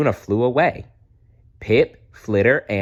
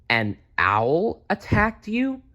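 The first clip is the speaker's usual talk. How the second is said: high, slow disbelieving ask; astonished question; 11 semitones higher, slower than usual. Acted. Mother bat to stranger, high slow astonished question. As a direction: gasp it out in disbelief.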